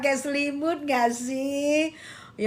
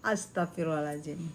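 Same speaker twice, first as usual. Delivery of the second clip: soft muttered exclamation; 11 semitones lower, 12 dB quieter, faster than usual. Real, not acted.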